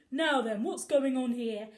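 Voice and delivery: loud, firm bobby asking questions; a copper demanding answers; stern policeman. A narrator's reading.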